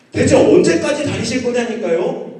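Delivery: loud, high outburst imitating an exasperated wife; wife's repeated demand. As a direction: demand an answer at full volume. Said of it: "와이프가 한 번 더 그러는 거예요".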